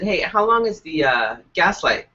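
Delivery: ordinary talk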